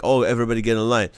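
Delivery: ordinary delivery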